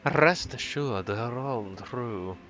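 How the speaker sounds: slow, measured character voice; stately, drawn-out delivery; game character read aloud. Acted. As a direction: read it slowly and deliberately, in character.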